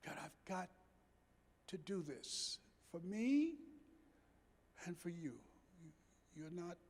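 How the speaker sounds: soft, near-whispered vow to his mother; hushed tender promise. Retold or imitated. Son quietly promising his ailing mother, hushed and tender. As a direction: drop to a hush, full of feeling.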